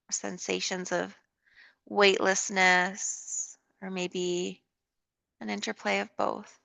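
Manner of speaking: calm, unhurried yoga guidance with long pauses; guided meditation. Real, not acted. Leads a yoga relaxation.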